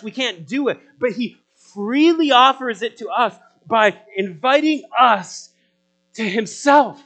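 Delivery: loud, high, slowed preaching cry; impassioned preaching. Acted. Pastor urging listeners that rest is free, impassioned preaching. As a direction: lift the promise up and out.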